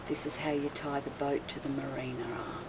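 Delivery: retold whisper of a friendly marina helper. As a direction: whisper it like a private tip. Retelling helper's quiet boat lesson.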